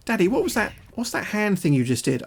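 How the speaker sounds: put-on high, innocent child's voice; imitating young daughter. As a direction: ask dad like a curious little girl. Voicing daughter's innocent question.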